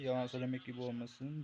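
ordinary talk

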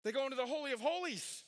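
high, forceful pulpit delivery; impassioned preaching. Real, not acted. Preacher showing Jesus surpasses every high priest, impassioned preaching. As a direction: preach it with rising conviction.

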